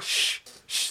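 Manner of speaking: breathy shushing hiss. Retold or imitated. Crowd shushing him at the meeting, hushed. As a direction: shush the complainer. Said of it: "they're like".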